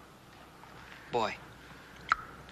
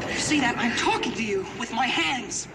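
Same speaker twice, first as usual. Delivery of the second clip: higher and louder, overjoyed; overjoyed teacher shouting with pride; joyful shouting; 7 semitones higher, 3 dB louder than usual. Real, not acted.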